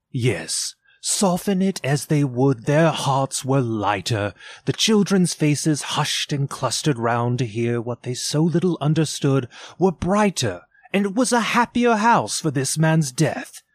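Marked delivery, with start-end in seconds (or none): none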